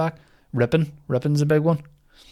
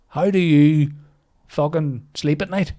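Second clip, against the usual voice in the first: angry; 3 semitones higher, 4 dB louder than usual. Acted. Angry rant at imagined person.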